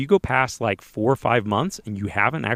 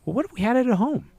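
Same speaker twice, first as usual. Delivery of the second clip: lightly voiced wife, much higher; a hint of the wife's tentative higher voice; wife's suggestion; 13 semitones higher than usual. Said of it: "And then she's like".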